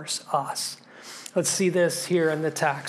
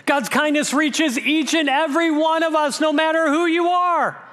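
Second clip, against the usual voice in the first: high, raised voice building to a peak; impassioned preaching; 9 semitones higher, 4 dB louder than usual. Real, not acted. Preacher proclaiming God's kindness to every listener, impassioned. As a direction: proclaim it high and driving, holding the last word.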